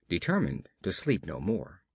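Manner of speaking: plain reading voice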